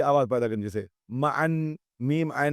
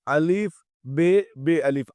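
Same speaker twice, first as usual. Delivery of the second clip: letter by letter, slow; slow classroom spelling; spelled out slowly; 2 semitones higher, slower than usual. Shown as a demonstration. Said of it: "دیکھیے، ابا بولتے ہیں،".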